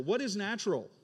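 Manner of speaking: ordinary delivery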